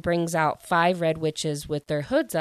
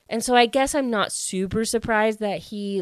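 ordinary delivery

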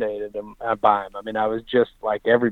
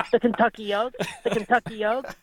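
imitating Frankel; 10 semitones higher than usual. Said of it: "He just kept saying".

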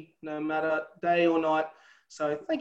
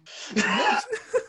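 burst of laughter. Real, not acted. Guest laughing at the host's Pocock question, high surprised burst.

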